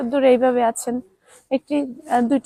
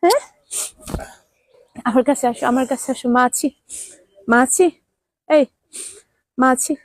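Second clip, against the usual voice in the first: usual speaking voice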